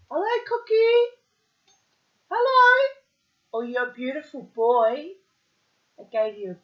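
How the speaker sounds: very high, loud cooing; pet talk. Real, not acted. Greeting the kookaburra Cookie outside, very high and loud.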